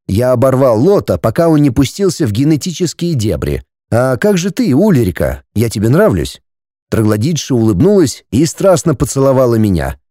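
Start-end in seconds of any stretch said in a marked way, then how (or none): none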